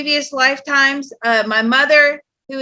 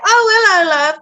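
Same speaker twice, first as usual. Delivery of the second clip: high, louder British greeting; lilting British accent, pitched up; British mom; 7 semitones higher, 4 dB louder, much faster than usual. Retold or imitated. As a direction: imitate a British mother's cheery hello. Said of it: "'cause my mom's British".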